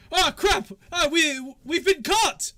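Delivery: high, loud panicked shout; panicked yelp. Acted. Bandit falling out of the bush, high panicked yell. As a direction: yelp in alarm at being caught.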